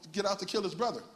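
usual speaking voice

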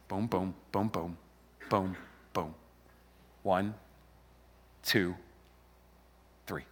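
very low, soft countdown; hushed count-in. Real, not acted. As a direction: count them in under your breath. Counting crowd in before shouting.

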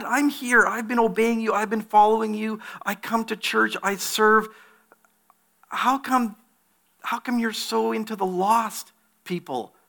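high, complaining whine to God; whiny churchgoer. Retold or imitated. Churchgoer grumbling to God about the lost, whining high. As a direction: whine it up high like a sulky believer. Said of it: "we look at that and say".

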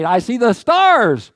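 high, loud, drawn-out proclamation; exultant hymn climax. Acted. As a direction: swell into a slow, ringing cry of wonder. Reciting hymn, rising to climax.

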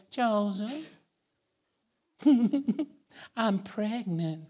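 soft, slow nervous giggle; nervous confession. Retold or imitated. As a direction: hush it out with a laugh. Mary confessing to Joseph.

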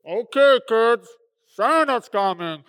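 imitated store PA voice; intercom announcement. Store man tells kids Santa's coming, intercom voice. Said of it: "the guy gets on the intercom".

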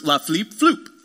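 mock French nonsense syllables in an odd voice; fake French gibberish. Husband dodging his French lesson with fake French. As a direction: make up French-sounding gibberish. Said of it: "he just makes something up".

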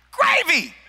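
high-pitched mock yell of a correction; exasperated high exclamation; exasperated outburst. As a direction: blurt it out high, as if it were obvious.

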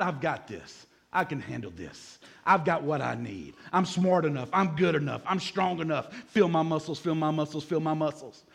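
low, cool self-confident boasting; put-on self-assurance. Preacher voices Christians' outside front, low cocky self-assurance. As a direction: act like you've totally got it handled, voice dropped. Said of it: "even though on the outside".

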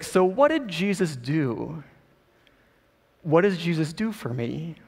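a hint of a slow, hazy man asking; lightly voiced man, slow and wondering; searching drug user. High man asks about Jesus.

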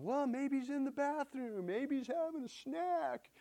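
high, sneering mockery; mocking Elijah. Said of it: "And Elijah even starts mocking them".

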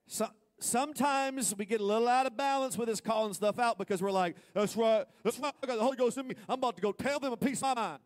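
loud, high preaching into a mock zealot; full-force preaching. Preacher mocking a zealot, loud and high. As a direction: push it up like a fired-up believer.

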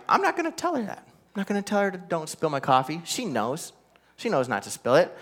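soft aside to himself; quiet, confiding self-talk; hushed inner thought. Retold or imitated. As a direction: drop the volume as if thinking privately. Retold private thought, spoken softly.

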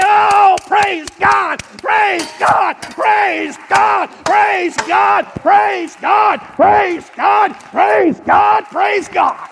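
loud, high, repeated Praise God; shouting praise God. Shown as a demonstration. Preacher acting out his healing praise, shouting. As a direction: cry out Praise God in triumph. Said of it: "I'm gonna raise both my hands".

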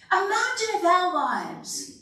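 ordinary talk, nothing marked

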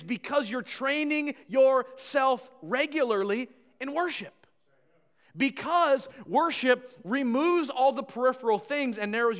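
sustained high-energy exhortation; forceful preaching. Real, not acted. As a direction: press each point on the congregation.

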